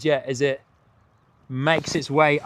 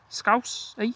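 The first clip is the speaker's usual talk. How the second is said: put-on Scouse accent; 9 semitones higher, 3 dB quieter than usual. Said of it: "What do you think to my, uh, Liverpudlian accent?".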